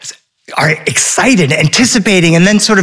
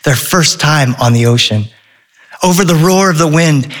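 usual speaking voice

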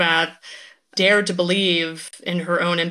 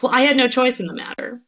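quick, higher-pitched mock helplessness; self-infantilizing; 6 semitones higher, faster than usual. Guest imitating a woman not taking responsibility, quick naive whine. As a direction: play the naive one dodging blame, in a rush. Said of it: "self-infantilizing perspective to be like".

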